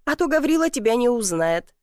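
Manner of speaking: ordinary delivery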